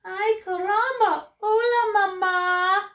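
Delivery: high, loud Spanish-flavoured chick; baby chick voice. Acted. Newly hatched chick greeting Jack, high and loud. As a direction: squeak it like a newborn chick. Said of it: "looked up at Jack and said".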